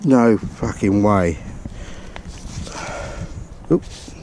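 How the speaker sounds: ordinary talk, nothing marked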